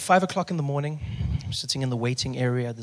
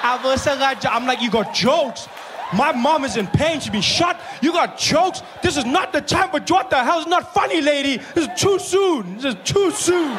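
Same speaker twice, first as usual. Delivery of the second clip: high, loud shouting in disbelief; loud mock outrage at a bad joke; outraged shout; 12 semitones higher, 7 dB louder, slower than usual. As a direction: yell it like it's too soon. Pharmacist joking, [name] outraged.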